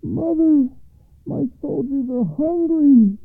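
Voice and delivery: high, loud call over a wall; son crying out. A narrator's reading. Oldest son to his mother over the wall, loud high cry. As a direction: call out urgently, slow and high. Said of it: "cried the oldest son".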